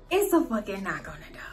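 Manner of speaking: hushed, breathless whisper as if someone might hear; dramatic whisper. Retold or imitated. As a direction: whisper it like you're hiding behind the door. Hushed reaction to a knock.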